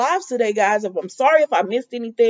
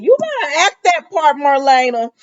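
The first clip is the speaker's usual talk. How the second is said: higher, louder, playful call at the screen; telling Marlena to act that part, excited; 7 semitones higher, 6 dB louder than usual. Retold or imitated. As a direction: cheer the actress on. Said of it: "and I said".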